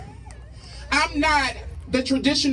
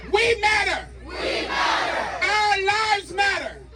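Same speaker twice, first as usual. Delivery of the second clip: rally speech; 3 semitones higher than usual. Acted. Spoken word rally poem, shouted.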